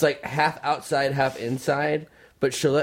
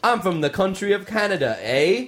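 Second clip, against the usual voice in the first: higher-pitched hammed-up Canadian accent with a tag eh; mock Canadian accent; 7 semitones higher than usual. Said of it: "An actor".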